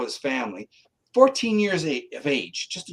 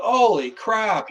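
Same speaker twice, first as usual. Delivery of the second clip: higher, slow, shocked; slow stunned exclamation; 6 semitones higher, 4 dB louder, slower than usual. Retold or imitated. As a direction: draw it out in disbelief. Retold shocked thought.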